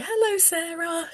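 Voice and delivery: put-on high, soft greeting to a little girl; adult greeting. Shown as a demonstration. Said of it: "if I say".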